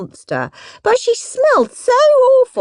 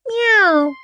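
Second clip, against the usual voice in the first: meow; 9 semitones higher than usual. Acted. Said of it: "said Kitty Cat".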